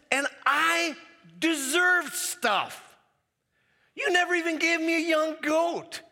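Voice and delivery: high, loud, slow, building into an indignant shout; older son to his father, rising into an angry shout; resentful complaint. Acted. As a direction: spit out the grievance like a wronged son.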